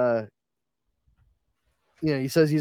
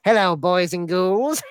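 higher, louder cackly ghoul host; Crypt Keeper voice; 7 semitones higher, 4 dB louder than usual. Retold or imitated. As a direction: greet them like a horror host. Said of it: "I think the Crypt Keeper's there".

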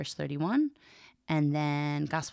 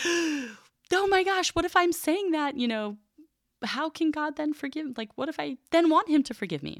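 horrified students; 12 semitones higher than usual. Retold or imitated. Students' voices in wide-eyed horror, mimicked high. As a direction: imitate a panicked student, voice way up. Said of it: "wide eyes and look of horror".

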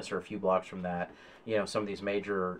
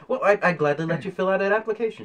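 higher, quick receptionist voice; obliging receptionist; 9 semitones higher, 5 dB louder, faster than usual. Acted. Receptionist offers job seeker an application, higher and obliging. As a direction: offer help briskly in a higher voice.

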